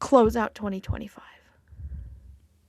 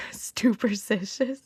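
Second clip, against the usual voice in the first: laughing; slower than usual. Real, not acted. Laughing through the word.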